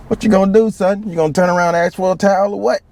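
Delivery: a sarcastic sergeant needling the recruit; high mocking drill instructor. Drill instructor taunting the recruit about his sweaty hands, high mocking voice.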